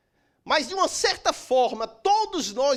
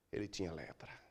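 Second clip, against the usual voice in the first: hushed reveal; 17 dB quieter than usual. Real, not acted. Dramatic reveal in sermon.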